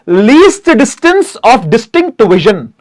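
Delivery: "least distance of distinct vision" spelled out very slowly, word by word; slow full form. Shown as a demonstration. Teacher slowly spells out the full form for students. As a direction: dictate the full form slowly, like writing it on the board. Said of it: "sir, what is एलडीडीवी?".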